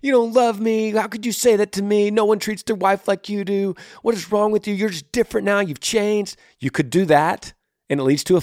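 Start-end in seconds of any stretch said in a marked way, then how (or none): 0.0-6.4 high-pitched aggrieved complaining; hammed-up, high, hurt protest; mock spousal fight. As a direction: act out a wife hurling accusations in a quarrel. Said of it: "Instead of fighting and being like".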